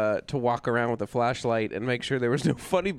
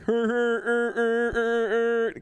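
higher, hammed-up horn honks; mock car horn noises; mock honking; 9 semitones higher, much slower than usual. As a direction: honk like a crowd of angry cars. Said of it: "everyone was like".

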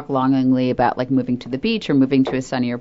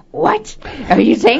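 mock offended; 9 semitones higher than usual. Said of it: "I'm like".

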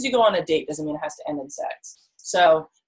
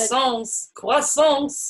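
hammed-up French accent, rising higher; 7 semitones higher, much slower than usual. Chiming in on partner's French date idea, hammed-up French accent. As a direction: relish it in a playful French accent. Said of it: "do a French accent".

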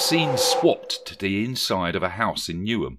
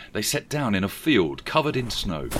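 usual speaking voice

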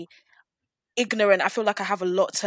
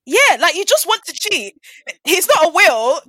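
loud, high-pitched indignant outburst; real exasperated ranting, loud and high; outraged rant; 11 semitones higher, 10 dB louder than usual. Real, not acted. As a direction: fire off the outrage, voice climbing high. Heated rant about cheating husband.

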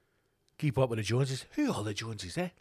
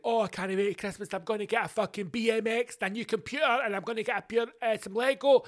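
put-on childish excitement; 7 semitones higher than usual. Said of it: "I was like".

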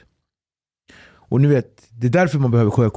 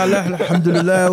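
higher, hurried mock praying; imitating prayer; 5 semitones higher than usual. Scared crewman praying on the boat, imitated fervently. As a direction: gabble the prayer in mock panic. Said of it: "han satte sig och be".

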